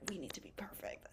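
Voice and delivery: whispered, mocking the type A voice. Healthcare student voicing the type A urge, whispered. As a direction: hush it like a secret anxious thought. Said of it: "we're a lot of type A".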